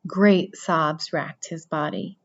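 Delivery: plain reading voice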